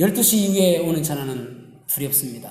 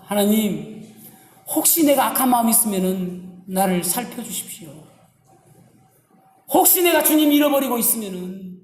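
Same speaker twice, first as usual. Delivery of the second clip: earnest prayer voiced as David, pitched higher; David's confession; 5 semitones higher than usual. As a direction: plead with God to search you. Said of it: "이렇게 고백합니다". (다윗의 간절한 고백 기도)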